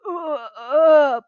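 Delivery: loud pained groans, pitched high; a beaten giant moans in pain; cyclops moaning. Acted. Giant moans up at Percy, loud and pained. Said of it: "Polyphemus moaned".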